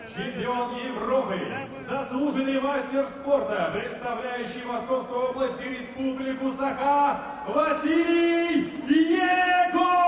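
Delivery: long booming sung-out name; boxing ring announcer. Real, not acted. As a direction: stretch the name out for the arena. Ring announcer shouts fighter's name.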